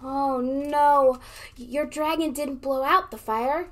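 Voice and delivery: ordinary talk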